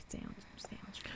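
unvoiced fading whisper. Real, not acted. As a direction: whisper it like a confession. Whispered joke about podcast.

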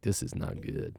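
hushed, breathy whisper of worry; worried whisper. Retold or imitated. Husband whispering his worry to wife Amy. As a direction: whisper it like bad news you don't want to admit. Telling wife about worsening leg.

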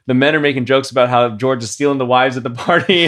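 high, loud, giggly telling; amused, laughter breaking in between phrases; laughing while telling. Real, not acted. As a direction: tell it through bursts of laughter.

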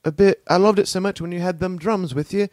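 put-on a kid missing the old songs; wistful orphan kid.

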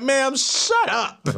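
high, slow exasperated outburst; losing the act. Retold or imitated. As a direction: drop the act and snap, half laughing. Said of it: "that's when I lost all the act".

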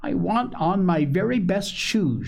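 put-on high eager little girl voice; a little girl begging her mother; little girl crying out. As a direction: plead like a small child wanting her best shoes. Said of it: "she cried".

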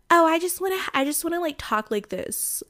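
mock putting on a show in a high-pitched voice; put-on voice. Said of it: "me talking in, like, different, like, accents and voices".

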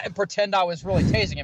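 ordinary talk

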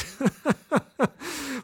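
long soft falling laugh. Real, not acted. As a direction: laugh at your own joke. Laughs at the spoonerism.

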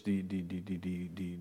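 a quick, soft mock stammer on die; stumbling over die, die, die like Moses; imitating a stutter. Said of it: "die stotterde een beetje kennelijk". (nagedaan gestotter op die, zacht en haperend)

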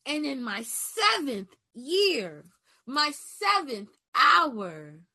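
loud, high preaching cry that rises on each come on; full-force sermon, pushing each phrase out loud and high. Real, not acted. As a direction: preach it like a revival pulpit. Preaching build on option.

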